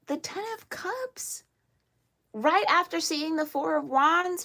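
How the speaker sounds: real thrilled outburst; almost shouting. Real, not acted. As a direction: nearly shout the card name in real delight. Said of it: "I almost shouted".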